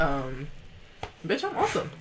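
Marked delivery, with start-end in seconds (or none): 1.3-1.9 sassy. Real, not acted. Self-affirmation.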